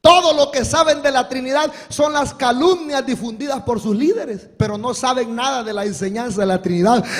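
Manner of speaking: ordinary talk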